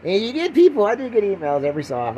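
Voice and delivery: normal talking voice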